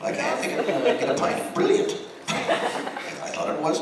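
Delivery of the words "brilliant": high mock Irish brilliant. Teasing the Irish audience about their favorite word, high mock Irish. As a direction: squeak out brilliant the way the Irish crowd says it. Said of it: "You all say brilliant".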